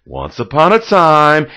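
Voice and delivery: storybook opener, cheerful and loud; fairy-tale opening. Shown as a demonstration. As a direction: sing out the classic story opening. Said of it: "You have to start off with".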